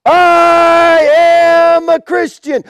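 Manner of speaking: long drawn-out, high shout; acted martyr's defiant proclamation; bold declaration. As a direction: cry it out to a whole arena, stretching the words. Martyr repeats his cry to emperor.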